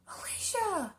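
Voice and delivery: ordinary delivery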